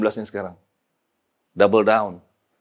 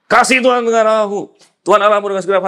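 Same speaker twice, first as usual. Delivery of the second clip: loud proclamation; 9 semitones higher, 6 dB louder than usual. Acted. Preacher proclaims the great commandment.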